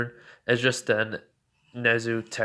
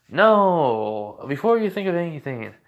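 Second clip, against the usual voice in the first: loud, high, drawn-out no; emphatic denial; 5 semitones higher, 6 dB louder than usual. Retold or imitated. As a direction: cut off the wrong idea with a raised voice. Heads off All Might's wrong conclusion.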